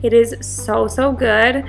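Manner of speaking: ordinary talk, nothing marked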